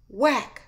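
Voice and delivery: loud, high, sudden whack; punchy impact word; imitated whack. Shown as a demonstration. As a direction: hit the word like a blow. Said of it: "Impact noises".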